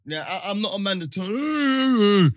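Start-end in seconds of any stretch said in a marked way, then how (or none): 1.3-2.3 long, high, loud drawn-out noise; loud sustained vocal effect; imitated turning noise.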